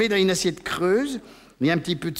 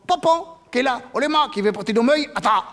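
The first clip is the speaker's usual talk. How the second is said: wolf at the door; 7 semitones higher than usual. Retold or imitated. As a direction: call through the door as the wolf.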